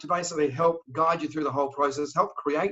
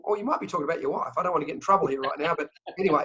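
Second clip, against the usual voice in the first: ordinary delivery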